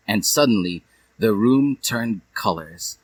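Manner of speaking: ordinary delivery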